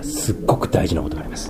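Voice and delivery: 声をひそめて大事さを伝える; emphatic whisper. Real, not acted. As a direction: drop to a hushed whisper to stress importance. 大事な点をささやきで強調. (ささやき声で「すっごく」を強調して)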